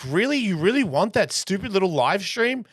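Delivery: high, mocking disbelief; incredulous. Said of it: "I'm like".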